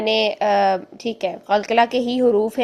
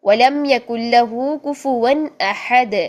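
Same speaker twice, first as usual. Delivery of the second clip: slow, drawn-out Quran recitation; much slower than usual. Shown as a demonstration. Teacher reciting Surah Ikhlas as an example. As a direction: recite with the dal echoing. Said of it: "دال کو ہلانا ہے".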